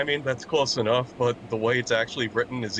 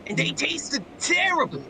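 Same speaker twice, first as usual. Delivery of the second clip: squeaky cartoon voice, much higher; silly high voice; 13 semitones higher than usual. Joining the South Park bit in a squeaky high voice. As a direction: pitch way up like a cartoon kid.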